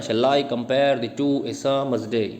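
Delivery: slow, metrical "Shall I compare the two a summer's day?" with every second syllable stressed; iambic rhythm. Shown as a demonstration. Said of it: "ऐसे ही बोला ना मैं".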